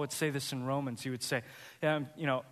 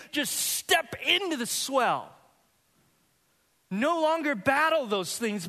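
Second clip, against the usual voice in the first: fired-up preaching; 7 semitones higher than usual. Acted. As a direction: preach it to the congregation with rising fire. Preacher builds to full force.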